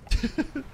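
high, short burst of laughter; brief high-pitched chuckle; real quick giggle. Real, not acted. Laughing at the game.

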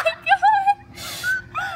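high, breathless shriek of laughter; laugh-scream from the shock. Real, not acted. After the plunge.

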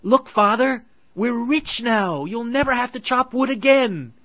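high, very loud, joyful; excited boy. A narrator's reading. Hansel to father showing gold, joyful. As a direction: shout the good news.

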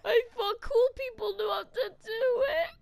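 crying. Acted. Crashed his car, upset.

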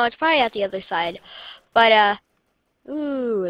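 ordinary talk, nothing marked

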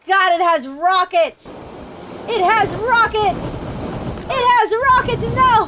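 real mock-panic yelling; alarmed excitement. Real, not acted. Rockets suddenly appear.

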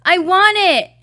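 put-on loud, high child's shout; demanding child shout. A narrator's reading. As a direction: yell it as a child grabbing a toy. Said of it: "I shouted".

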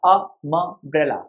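अ म ब्रेला split syllable by syllable, slow; sounded out slowly. Shown as a demonstration. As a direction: break अ म ब्रेला into separate syllables. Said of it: "लेकिन पढ़ा क्या था?".

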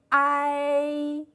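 ai held long, mouth going from wide open to flat; drawn-out pinyin ai. Shown as a demonstration. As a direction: glide smoothly from a into i, steady and even. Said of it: "请同学们观察老师口型的变化".

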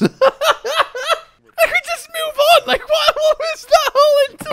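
high, loud shrieking through laughter; laughing hard. Real, not acted. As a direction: squeal the words while cracking up. Cracking up mid-sentence.

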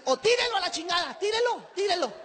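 high mock yell at the passenger; stewardess yelling. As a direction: shout the order up high like the angry attendant. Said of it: "todavía te dice".

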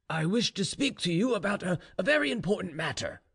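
lightly dramatised, grave general; earnest general. A narrator's reading. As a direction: broach a serious matter with your daughter. General addresses his daughter.

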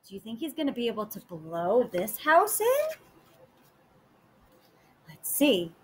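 usual speaking voice

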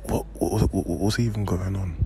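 startled hushed whisper. Real, not acted. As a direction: whisper in disbelief. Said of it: "I fully have to whisper".

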